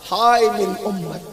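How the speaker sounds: low grieving lament; mournful lament. Acted. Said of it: "میرے آقا فرماتے ہیں".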